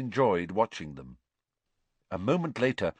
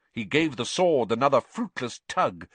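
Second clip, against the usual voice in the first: ordinary delivery, nothing marked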